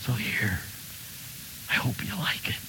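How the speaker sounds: soft, tender offering, fading to a whisper; tender gift giver, soft to a hush; loving giver. Retold or imitated. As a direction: give it gently with love. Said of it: "an expression of love".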